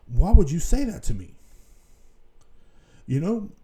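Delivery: baffled and hurt, pushing back; real disbelief. Real, not acted. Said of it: "that shit fucking flipped me out".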